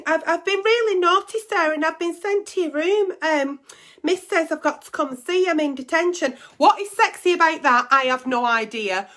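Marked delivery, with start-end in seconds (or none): 0.1-6.4 naughty schoolgirl. Pretend. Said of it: "And you're gonna be like".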